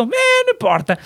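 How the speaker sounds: high, put-on voice that doesn't care; mock carefree dismissal; careless shrug. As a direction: toss it off like nothing matters. Acting out his own carefree shrug.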